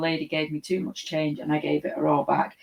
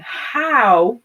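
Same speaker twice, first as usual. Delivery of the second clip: loud, high incredulous outburst; indignant disbelief; 6 semitones higher, 9 dB louder than usual. Real, not acted. Host reacting to a viewer's dog question, incredulous. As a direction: exclaim it in playful outrage.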